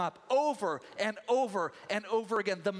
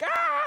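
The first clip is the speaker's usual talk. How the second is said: high, loud shriek of fright; startled scream; 8 dB louder than usual. Acted. Moses shrieking at the snake, loud and high. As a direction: jump back screaming. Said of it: "it suddenly becomes a snake".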